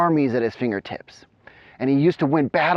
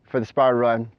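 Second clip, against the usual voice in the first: ordinary talk, nothing marked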